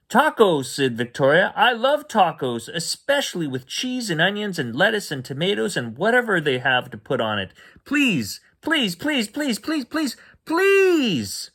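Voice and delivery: put-on high, excited child voice; a little girl begging for her favourite food; squeaky pleading kid; eager little girl.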